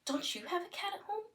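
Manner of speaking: high, breathless whispered wonder at the cat; excited whisper. Shown as a demonstration. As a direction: whisper it like an amazing discovery. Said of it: "it could be like".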